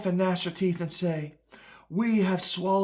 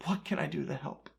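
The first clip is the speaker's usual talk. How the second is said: soft echo; 5 semitones lower, 10 dB quieter than usual. Acted. Jeremiah asking weeping Jerusalem how to help, soft helpless echo. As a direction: let it fall away like a helpless echo. Repeated lament line, softer echo.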